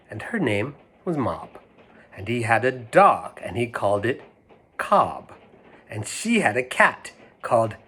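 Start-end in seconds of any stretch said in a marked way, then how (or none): none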